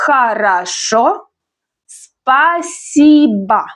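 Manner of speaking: slow Хорошо, pause, very drawn-out спасибо; syllable-by-syllable Хорошо, спасибо; pronunciation demo. Shown as a demonstration. Teacher models Хорошо, спасибо for learners, very slow. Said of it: "the stress is on the last syllable".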